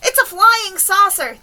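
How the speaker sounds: high, loud, drawn-out cry; shouted alarm. A narrator's reading. Onlookers spot a flying saucer, shouted. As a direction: shout it slowly to the whole crowd. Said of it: "they shouted".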